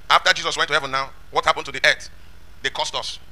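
ordinary delivery

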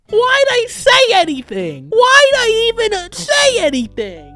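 very high, loud wail of regret; drawn-out, high-pitched self-reproach; anguished yell. Real, not acted. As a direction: scream in frustration at yourself. Regrets jinxing the run after failing.

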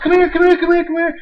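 high, loud, eager calling; wife calling him over in excitement; excited calling. Retold or imitated. Wife urging husband to the phone, high and loud. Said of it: "She was like".

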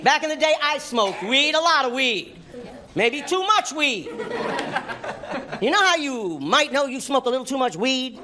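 ordinary delivery

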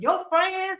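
high-pitched mock-indignant question; retold indignation. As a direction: mimic someone mad and challenging. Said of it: "Alicia was mad at me".

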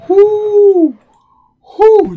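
loud, high whoosh of released breath; big relieved exhale. Real, not acted. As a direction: let the held breath out loudly. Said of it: "hold your breath for a good solid bit".

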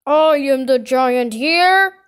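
loud, booming giant voice; angry giant's shout. A narrator's reading. Giant confronts Kipper in village, shouting. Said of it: "he shouted".

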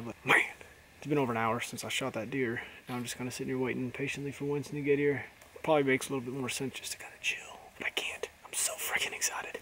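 low, quiet hunting murmur; hushed voice. Real, not acted. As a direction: keep it down in the woods. Quiet hunter talk in woods.

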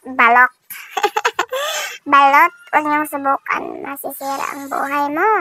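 laughing through covered mouth; muffled behind hand. Real, not acted. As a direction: mouth full, chewing. Seen 2: Hand covers mouth while eating balut; briefly drinks ~1.1-2.0s.

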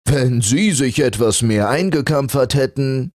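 a fur teasing the flannel; mocking. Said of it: "sagte der Pelz spöttisch".

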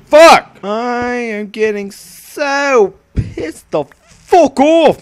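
sharp loud high curse; frustrated yell. Real, not acted. Gamer yelling at a setback, loud angry curse. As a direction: snap out a furious curse.

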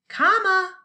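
loud, high mock yell of comma. Shown as a demonstration. Teacher demoing a student yelling comma. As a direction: shout the word comma. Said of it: "The student yelled".

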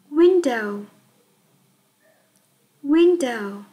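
"Window" said whole and clear for repeating; model word. Shown as a demonstration. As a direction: model "Window" slowly for children. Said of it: "chúng ta cùng đọc nào". (đọc mẫu "Window" rõ ràng cho các em)

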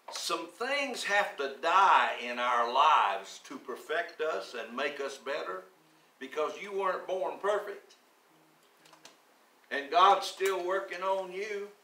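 slow, emphatic preaching. Acted. Pastor exhorting the congregation, slow and emphatic. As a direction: press each phrase home firmly.

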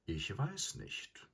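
soft, slow, drowsy words; sleepy. A narrator's reading. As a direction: drift off as you speak. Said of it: "sagte er schläfrig".

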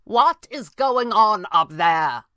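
loud, slow, high shout upward; demanding shout to someone overhead; calling out. A narrator's reading. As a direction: call up to someone far above. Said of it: "Pavli called".

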